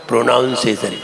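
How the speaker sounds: lightly mocking the wrong "pro-NOUN-ciation"; mispronounced word. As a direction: imitate the common wrong प्रोनाउंसिएशन. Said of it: "कई लोग क्या बोलते हैं".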